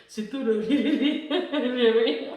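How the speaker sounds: loud real laugh through the words. Real, not acted. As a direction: laugh hard as you say ri ri. Laughing about the word.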